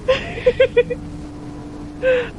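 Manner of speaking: high strained laugh through pain. Real, not acted. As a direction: laugh it out while hurting. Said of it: "I'm laughing through the pain".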